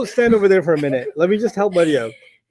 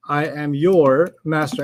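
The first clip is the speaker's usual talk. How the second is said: slow, measured demonstration; unhurried, one word at a time; slower than usual. Shown as a demonstration. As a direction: spell it out slowly for a learner. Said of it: "You just gotta slow it down".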